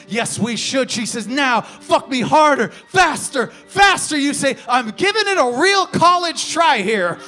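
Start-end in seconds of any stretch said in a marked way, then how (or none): 1.3-4.2 rising to a shout on the last faster; louder and higher with each word, demanding; escalating loud, demanding cries; urgent demand. Acted. Her demands in the sex poem. 4.7-7.2 higher, loud comic shout; loud, sheepish shouted excuse; yelled retort. Acted. His retort, yelled over the build.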